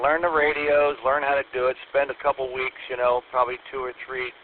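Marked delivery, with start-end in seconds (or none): none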